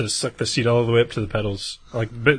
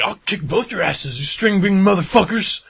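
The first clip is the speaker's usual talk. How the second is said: angry threat; 9 semitones higher than usual. Retold or imitated. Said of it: "he was like".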